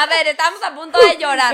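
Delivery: loud laughing protest. Real, not acted. Friend points out they were about to cry, laughing loudly. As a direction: laugh while trying to get serious.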